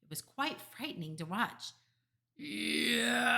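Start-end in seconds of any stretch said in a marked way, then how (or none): 2.4-3.4 loud, higher howl of a giant; roared yowl. A narrator's reading. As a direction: roar it out, long and loud. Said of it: "roared the flesh lump eater".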